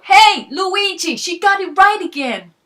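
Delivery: very loud, high, drawn-out shout to the kitchen; calling loudly to the back. Acted. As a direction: yell back through the store. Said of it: "called loudly to the back of the store".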